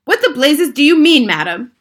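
loud, high blast of indignation; outraged shout. A narrator's reading. Father challenging grandmother over his son, outraged shout. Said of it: "shouted Mister Jenkins".

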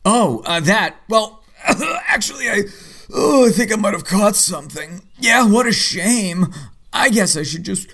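high, hammed-up sickly whine; faking sick. Husband to Dinah, pretending sickness to skip sparring, hammed-up high whine. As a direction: ham up being ill to dodge the lesson. Fakes illness to skip kickboxing.

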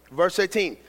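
ordinary talk, nothing marked

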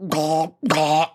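strangled throaty groan through a mouthful of spit; gurgling dental-chair groan; groaning helplessly at the dentist; mock groan. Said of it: "And you're just like".

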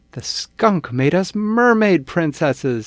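high, loud, delighted cry; thrilled. Acted. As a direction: burst out with joy. Greta thrilled by the wish.

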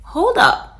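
high, sudden hold up. Pretend. Stopping your own negative thoughts, high and sudden. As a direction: catch yourself mid-spiral. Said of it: "reframe your mind and think".

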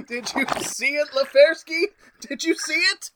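high, rising excited questions; gleeful discovery; thrilled, giddy. Acted. Doc thrilled by the experiment.

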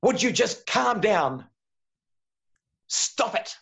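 high, slow, exasperated "calm down"; mock exasperated parent, pitched high. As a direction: play the fed-up parent snapping at a child. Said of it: "we say to them things like".

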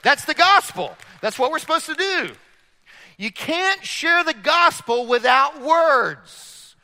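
loud, high, fervent preaching; full-throated revival preaching; preaching at full force. Acted. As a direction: preach it out to the whole room.

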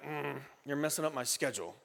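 low, soft mock grumble; grumbling. Churchgoer complaining about guests upsetting the schedule, grumbling. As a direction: grumble it under your breath. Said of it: "A grumbling, like".

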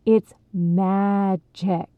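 "magic" stretched out very slowly; slow, lingering stress on "magic"; drawn-out emphasis. A narrator's reading. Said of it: "drew the word out for emphasis".

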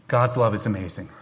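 ordinary delivery

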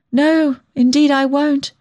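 high, slow, wretched promise; miserable. A narrator's reading. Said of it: "I said miserably".

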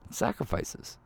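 breathy whisper for weight. Acted. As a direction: drop to a whisper on the key truth. Sermon emphasis.

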